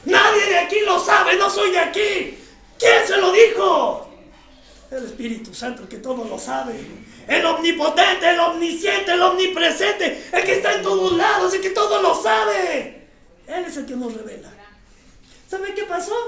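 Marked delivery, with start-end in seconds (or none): 0.0-4.1 retold shout. Retold man crying out. 4.9-14.5 preaching. Real, not acted. Wife's answer preached.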